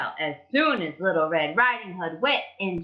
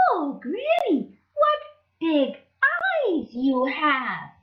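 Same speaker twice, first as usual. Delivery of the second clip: put-on high, slow child voice; a curious, drawn-out question; wondering Little Red; 8 semitones higher, slower than usual.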